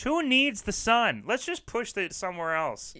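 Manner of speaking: hammed-up outrage at the sun; mock outrage.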